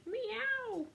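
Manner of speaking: high mewing cat sound; cat meowing; angora meow. Acted. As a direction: mew it like a dainty cat. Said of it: "The Angora said".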